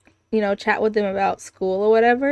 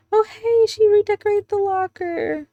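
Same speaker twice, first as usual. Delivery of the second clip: high-pitched excited discovery; delighted, sing-out high reaction; pleasant surprise; 11 semitones higher than usual. Real, not acted. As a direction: squeal a little at what you just spotted. Spots a change in the game.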